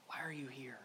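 low, very soft whisper. Real, not acted. Preacher putting God's question to listeners, whispered. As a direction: breathe the question out almost silently.

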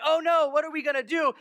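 high, loud worried cry; panicked servant. Acted. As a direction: sound the alarm like a frightened servant. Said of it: "hears from the servant".

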